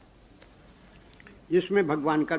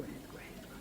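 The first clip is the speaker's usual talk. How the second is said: whisper; 21 dB quieter than usual. Acted. Brother-in-law signals her secretly.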